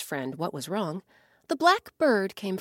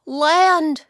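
a loud, high cry of discovery; excited cry; 8 semitones higher, 10 dB louder than usual. Acted. Blackbird crying out on spotting land, loud and high. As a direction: shout it like you just spotted land. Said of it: "he cried".

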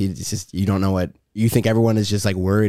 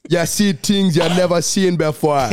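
slow, lilting Jamaican impression; Jamaican accent; slower than usual. Shown as a demonstration. Showing friends his best Jamaican, slow Jamaican accent. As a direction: stretch the words in a Jamaican accent. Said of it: "He's got the best Jamaican".

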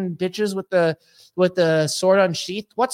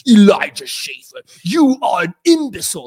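slow, loud villain sneer; sneering mock foreigner voice; Sebastian Gorka; 3 semitones higher, 6 dB louder, slower than usual. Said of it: "I'm sounding like Sebastian Gorka".

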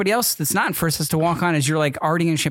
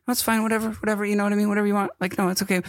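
higher, light-voiced imitation of a pushover; mock easygoing wife; 6 semitones higher, much faster than usual. Husband imitating his wife's usual agreeable tone. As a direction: mimic her shrugging everything off. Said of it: "usually you're like".

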